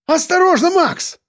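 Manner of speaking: sudden high shout of warning. A narrator's reading. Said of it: "закричал вдруг Сальников".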